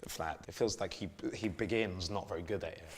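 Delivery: ordinary talk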